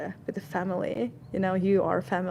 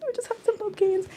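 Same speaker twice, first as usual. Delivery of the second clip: excited; 14 semitones higher, faster than usual. Real, not acted. Showing pumpkins.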